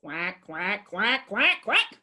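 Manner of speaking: lightly voiced quacking, rising; duck quacks. Answering what a duck says, mild quacking. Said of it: "What does a duck say".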